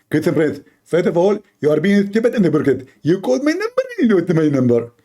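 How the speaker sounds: high, mocking preacher voice; Zakir Naik impression. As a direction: parody a lecturing cleric, pitched high. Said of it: "So Zakir".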